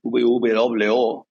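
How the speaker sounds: V W O said slowly with Spanish letter names; Spanish pronunciation. Shown as a demonstration. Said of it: "in Spanish, by the way, it's terrible".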